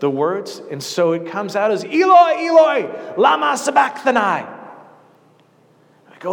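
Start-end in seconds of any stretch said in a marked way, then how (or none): 1.8-4.2 high, loud anguished cry to heaven; anguished scream. Acted. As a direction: scream it out in agony, much higher and louder. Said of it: "Jesus cries out and he's screaming".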